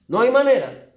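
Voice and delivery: high, loud cry of no way out; loud emphatic cry; emphatic preaching shout. Real, not acted. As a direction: hammer it home from the pulpit. Preacher exclaims at climax.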